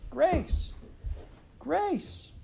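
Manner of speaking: very high loud screams; screaming. A narrator's reading. Carrie screaming in panic for her lost sister, very high. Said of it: "Carrie screamed".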